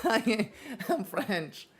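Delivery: slow, soft, laughing through it; laughing joke. Real, not acted. Streamer jokingly tells chat he's French, soft laughing and slow. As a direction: giggle out the confession slowly.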